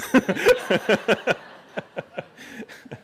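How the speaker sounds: a burst of loud, high laughter. Real, not acted. Preacher bursting out laughing at his own story, loud laugh. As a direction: crack up laughing at the punchline.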